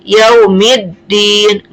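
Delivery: very slow "Yaumiddin", no l, straight from m into a held d; merged lam. Shown as a demonstration. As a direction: recite "Yaumiddin" drawn out with the lam melted into dd.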